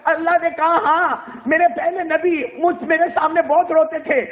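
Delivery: fervent preacher pleading; impassioned preaching. Acted. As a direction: press the point hard to the listeners. Preacher voices plea and reply.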